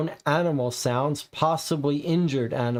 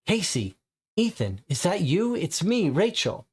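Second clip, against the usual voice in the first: different female voice; 6 semitones higher than usual. Acted. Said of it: "Different female voice".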